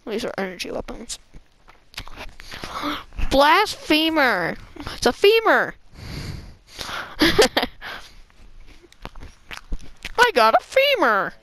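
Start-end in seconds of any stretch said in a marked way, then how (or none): 2.0-3.3 sharp breathy gasp; quick startled intake of breath. Real, not acted. Shocked gasp at item. 3.3-4.6 slow, high, loud declaration; dramatic outcry. Pretend. Mock dramatic outburst. 5.0-11.3 gleeful yell over a find; excited. Real, not acted. Excited about femur weapon.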